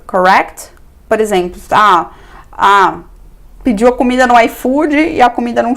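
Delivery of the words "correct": "correct" rising as a question; questioning tag. Shown as a demonstration. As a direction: end on "correct" with pitch going up. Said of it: "Sempre termina com interrogação".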